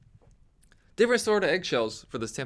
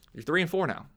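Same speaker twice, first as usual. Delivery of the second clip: ordinary delivery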